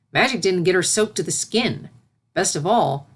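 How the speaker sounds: ordinary delivery, nothing marked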